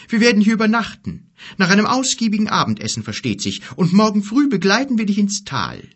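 fast, high, loud giant voice; eager giant. Acted. Giant planning the night in the cave, eager. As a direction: announce the plan briskly and loudly.

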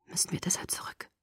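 hushed frightened whisper; whispering fearfully to Edward. Acted. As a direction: whisper the question. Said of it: "flüsterte ich".

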